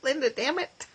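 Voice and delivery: fighting back real tears; choked up. Real, not acted. Choking up while reading her friend's prayer. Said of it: "I'm a little bit clubbed".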